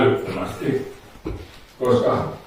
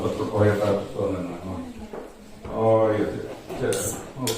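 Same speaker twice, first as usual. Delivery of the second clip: lower, softer, hushed hypnotic voice; deepening trance; 4 semitones lower, 7 dB quieter than usual. Acted. Hypnotist taking a volunteer deeper into trance, soft low hush. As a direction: sink them deeper, gently.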